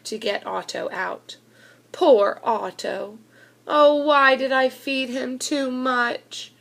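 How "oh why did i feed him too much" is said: ordinary delivery, nothing marked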